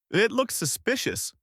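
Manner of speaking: high flustered sputter. A narrator's reading. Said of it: "he sputters".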